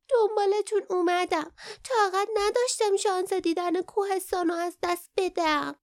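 high-pitched little creature voice; young creature. A narrator's reading. Young Kin explaining to Lief why it followed, high-voiced. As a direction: pipe it up high like a small creature.

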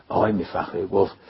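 ordinary delivery, nothing marked

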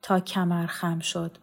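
plain reading voice

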